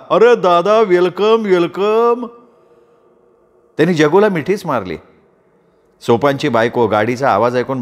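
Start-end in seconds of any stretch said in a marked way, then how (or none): 0.1-2.3 shouting welcome. Acted. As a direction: call out to an old friend with delight. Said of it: "एकदम ओरडला". (आनंदाने ओरडून स्वागत)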